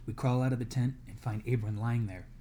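ordinary delivery, nothing marked

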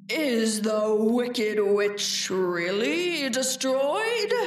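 very slow, wavering wizard voice; slightly trembling. A narrator's reading. Wizard asking after the witch, slow and a little tremulous. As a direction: draw it out uneasily, rising at the end. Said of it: "Dorothy thought it trembled a little".

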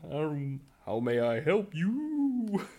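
slow, drawn-out whale Connery; Sean Connery whale voice. Acted. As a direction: stretch it like a singing whale. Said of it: "Running Sean Connery whale program".

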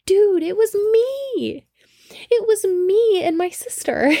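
very high, drawn-out insistence; excited reveal. Retold or imitated. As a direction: break the news in a high, stretched voice. Retold big reveal to friend.

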